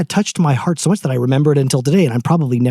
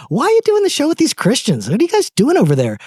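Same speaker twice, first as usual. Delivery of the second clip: mock skeptical question; 12 semitones higher than usual. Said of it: "I get asked all the time".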